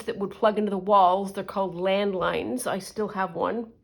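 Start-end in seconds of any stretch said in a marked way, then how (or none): none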